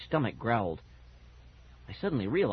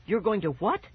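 high-pitched disbelief; crying out; 7 semitones higher than usual. A narrator's reading. Girl challenging the ghost dog, crying out. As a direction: question it high, scandalised. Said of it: "Fergie cried".

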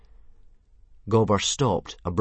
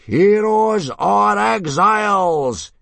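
long, high, drawn-out bellow; battle-cry yell; 12 dB louder, much slower than usual. A narrator's reading. Instructor rallying boys before the test, long yell. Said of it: "yelled Gobber the Belch".

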